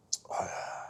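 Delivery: long unvoiced sigh; weary sigh. Real, not acted. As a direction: breathe out, tired of it. Pausing on art scene.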